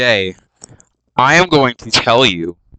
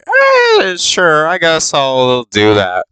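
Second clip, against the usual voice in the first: high squeaky "eh", then grudging; half-hearted agreement; reluctant shrug; 5 semitones higher, slower than usual. Retold or imitated. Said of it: "he's like".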